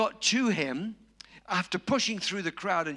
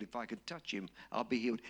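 quiet murmur; 8 semitones lower, 7 dB quieter, faster than usual. Retold or imitated. The woman repeating her hope to herself, in a soft murmur. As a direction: lower and softer, said under the breath.

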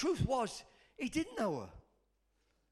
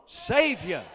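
loud congregational call; shouted; 11 dB louder than usual. Shown as a demonstration. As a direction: shout it out to lead the crowd. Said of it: "Shout it loud".

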